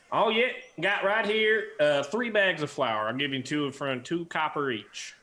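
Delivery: high, loud Southern shopkeeper; a folksy trader quoting prices; Southern dwarf, eager; Southern accent. Acted. Georgia offers flour.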